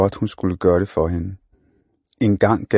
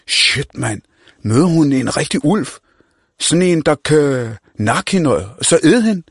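put-on cocky street-kid voice; brash young slang talker; Mohammed; 6 semitones higher, 4 dB louder than usual. Mohammed excited about the wolf.

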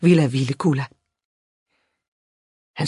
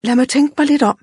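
put-on high-pitched thoughtful child's voice; pondering girl; 9 semitones higher, faster than usual. A narrator's reading. Red-haired girl answering the fine gentleman, pondering. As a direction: think it over aloud, up high. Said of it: "rynkede eftertænksomt panden".

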